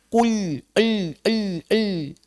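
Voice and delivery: "el" very light with the tongue tip; thin lam. Shown as a demonstration. Teacher drilling a light lam for students. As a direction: tap "el" thinly with the tongue tip. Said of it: "hanya ujung tepi lidahnya".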